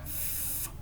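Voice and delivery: voiceless f- hissed through lip and teeth; just air, no voice, on the f; unvoiced f demo. Shown as a demonstration. Teacher contrasts f with v for the class. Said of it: "E se você disser".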